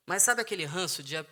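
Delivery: ordinary talk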